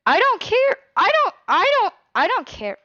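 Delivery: high, loud, exasperated I don't care; frustrated outburst. Real, not acted. To Nutella about Lobster wrecking him, high exasperated outburst.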